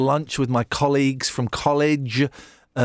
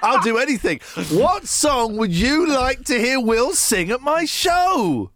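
exasperated raised voice; 9 semitones higher than usual. Real, not acted. Host exasperated at evasive caller.

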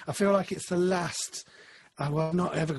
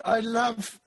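usual speaking voice

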